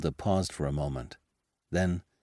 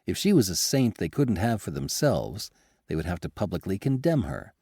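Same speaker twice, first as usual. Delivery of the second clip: usual speaking voice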